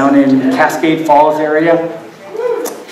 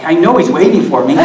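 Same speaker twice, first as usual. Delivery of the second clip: ordinary delivery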